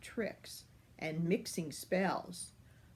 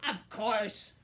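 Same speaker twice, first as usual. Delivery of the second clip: self-important wolf; 2 semitones higher than usual. A narrator's reading. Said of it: "growled the wolf importantly".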